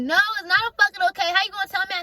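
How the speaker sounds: high, fast retold protest; angry cursing outburst. Retelling the fight to viewers after friend said calm down, angry cursing. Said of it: "I'm cursing so much".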